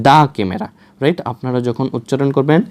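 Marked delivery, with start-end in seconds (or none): 0.1-0.7 দা camera, দা an open "daa". Shown as a demonstration. Teacher answering how it is pronounced, দা as "daa". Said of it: "সেক্ষেত্রে এটা কি হবে?".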